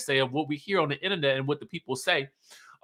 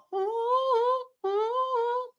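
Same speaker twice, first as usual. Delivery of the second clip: real squealing laugh, tickled; helpless high-pitched laugh; high burst of laughter. Real, not acted. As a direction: break into a high giggle. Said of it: "this whole scene tickled me pink".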